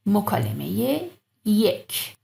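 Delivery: usual speaking voice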